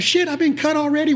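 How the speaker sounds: high squeaky panicked cry of a player just cut; sudden high-pitched dismay; movie quote impression. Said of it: "when Wesley Snipes' character gets cut".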